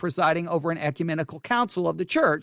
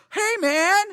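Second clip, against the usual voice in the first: much higher, louder put-on whine; mock whiny voice; 11 semitones higher, 4 dB louder than usual. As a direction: play the offended scold as a high caricature. Mocking the commenter's hypocrisy.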